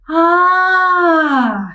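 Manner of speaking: a long drawn-out sympathetic ah; the crowd sighs Ah in sympathy; crowd exclaims. A narrator's reading. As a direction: sigh it out together in sympathy. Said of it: "exclamou a multidão".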